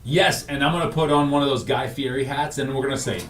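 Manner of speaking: ordinary talk, nothing marked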